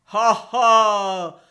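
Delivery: loud, sly "ha ha" of a fox; a wary fox laughing at them; suspicious fox laugh. A narrator's reading. Fox sizing up goat and ram, suspicious laugh. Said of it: "said the fox suspiciously".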